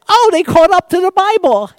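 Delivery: delighted that science caught up; mock surprise. Preacher retelling his amused, high reaction to an article. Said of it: "I went".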